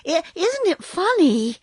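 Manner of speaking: high, uneasy and hesitant; a jittery girl forcing a laugh; nervous girl. Acted. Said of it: "a bit nervous".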